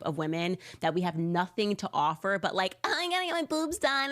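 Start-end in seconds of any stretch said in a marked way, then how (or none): 2.8-4.1 high, quick mocking airhead voice; ditzy woman, hammed up. Mocking shallow women on a podcast, high ditzy voice.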